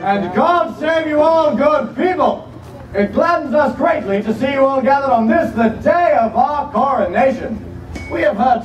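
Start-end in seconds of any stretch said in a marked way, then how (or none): 0.0-2.5 slow booming king's greeting; royal proclamation. Acted. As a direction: greet the crowd like a king. King greets the crowd.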